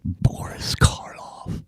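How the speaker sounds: slow eerie whisper; spooky whisper. Acted. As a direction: breathe the name like a horror host. Spooky horror host name drop.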